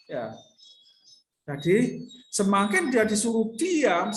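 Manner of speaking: ordinary delivery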